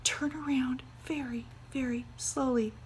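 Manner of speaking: terrified breathy whisper. Acted. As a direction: warn him without a sound. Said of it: "she whispered".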